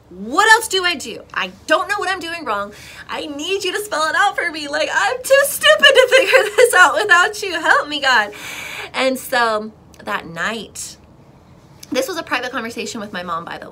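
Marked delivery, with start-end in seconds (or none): 0.1-8.3 high, loud, exasperated begging; desperate plea. Retold or imitated. Believer begging God to spell it out, desperate plea. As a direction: cry out for help, half laughing.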